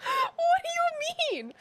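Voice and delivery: very high, drawn-out crying wail; tearful disbelief. Real, not acted. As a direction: wail it high and slow through tears. Overcome by the sad death.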